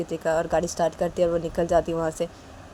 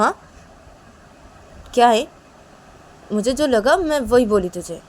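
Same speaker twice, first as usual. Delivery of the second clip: higher, mocking big sister; bossy sister; 7 semitones higher, 6 dB louder than usual. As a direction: sneer it out, pitch way up. Sister figure held.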